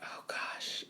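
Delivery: voiceless, nervous whisper; hushed oh gosh under the breath; retold whisper. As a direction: whisper it as the dread hits. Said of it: "and I was like".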